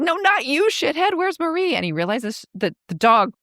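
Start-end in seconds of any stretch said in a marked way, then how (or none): 0.0-1.7 exasperated. Retold or imitated. Navin scolding the dog.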